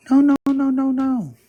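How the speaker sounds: high, loud, sing-song no no no; mocking sister denial. Teller mimicking her sister's loud, high denial. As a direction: squeal the denials like a defensive kid sister.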